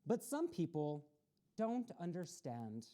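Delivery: a steady picture-book reading voice, pausing between pages; storybook read-aloud. A narrator's reading. Children's story host reading the book to young listeners, read-aloud voice.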